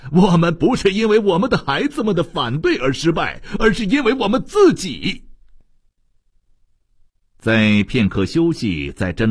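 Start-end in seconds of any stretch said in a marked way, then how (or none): 0.1-5.3 high, impassioned shouted protest; high-pitched, vehement declaration; loud cry. A narrator's reading. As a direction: cry it out as an agitated officer. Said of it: "大声地喊道".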